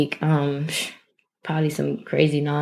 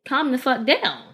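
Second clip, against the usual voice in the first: higher-pitched firm telling-off without yelling; firm, not yelling; 7 semitones higher than usual. Shown as a demonstration. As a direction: tell them firmly to settle, no shouting. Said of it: "That's not me yelling back. That's me saying".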